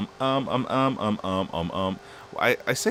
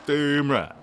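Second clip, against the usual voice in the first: smug, a bit louder and higher; cocky retort; 7 semitones higher, 6 dB louder than usual. Retold or imitated. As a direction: toss it off like a proud know-it-all. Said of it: "And I'm like".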